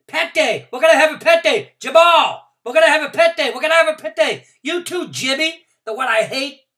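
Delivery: very high, very loud, breathless yelling; excited hollering. A narrator's reading. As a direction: holler it to every kid in the room. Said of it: "I hollered".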